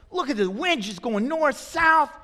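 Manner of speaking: high, lively acted observation; animated exclamation. [name] watching the wind whip around, animated and high.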